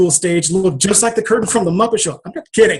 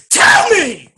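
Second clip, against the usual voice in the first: high, loud demand; demanding shout; 9 semitones higher, 5 dB louder than usual. Retold or imitated. Man insisting the voice answer him, loud and high.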